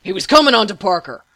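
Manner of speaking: loud, fast, angry retort; heated. A narrator's reading. Patient justifying hitting a guard, heated. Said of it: "Elliot said with heat".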